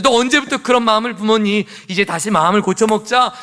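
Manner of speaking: acted reproach, easing slightly at the end; pressing rebuke. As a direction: press them to change their ways. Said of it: "이렇게 다그쳐야죠".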